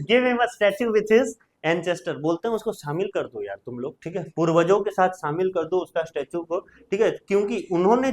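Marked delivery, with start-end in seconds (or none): 0.0-2.2 slow, raised Shakespeare reading; slow recitation. Shown as a demonstration. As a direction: read it out slowly and a bit higher. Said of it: "second citizen".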